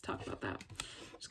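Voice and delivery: whispered aside; whispering. Real, not acted. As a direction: whisper it to yourself. Aside under breath.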